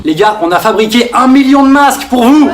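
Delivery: shouted high and proud. Acted. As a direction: yell it like a proud boss. Factory boss shouting proudly. (cri fier et aigu)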